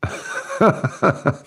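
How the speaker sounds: a quiet laugh under the breath; soft chuckle. Real, not acted. Streamer quietly laughing, still amused by the seafood bit. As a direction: chuckle softly to yourself.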